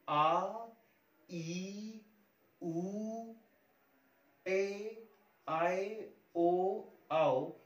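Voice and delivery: vowels "आ, ई, उ" held out, slowly stretched; long vowels. Shown as a demonstration. Teacher showing students the long vowels, slow and stretched. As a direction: draw each vowel out longer, "ओ, आओ" very drawn. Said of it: "ये सभी दीर्घ स्वर है".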